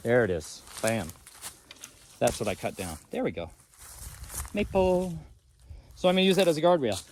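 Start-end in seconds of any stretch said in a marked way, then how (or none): none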